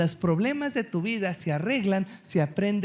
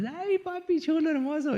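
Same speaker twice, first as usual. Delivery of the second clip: higher, mock childish praise; sweet little girls; 10 semitones higher than usual. Daughters praise their dad, higher sweet childish coo.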